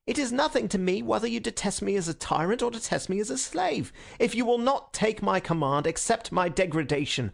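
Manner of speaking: high, fervent, pained entreaty; martyr's plea. A narrator's reading. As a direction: implore them as if beyond pain. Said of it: "a martyr who in an ecstasy of pain".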